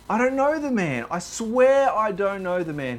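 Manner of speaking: high, frantic denial under oath; desperate swearing. Retold or imitated. Peter swearing to his accusers, high and frantic. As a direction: swear your way out of it. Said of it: "call down curses on himself".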